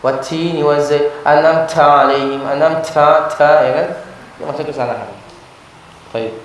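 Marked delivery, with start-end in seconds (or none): 0.0-3.6 slow recitation with hissy overdone t; overdone qari reading. Retold or imitated. Teacher imitating a reciter's wrong reading, slow and hissy. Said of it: "ada yang bacanya kayak gitu".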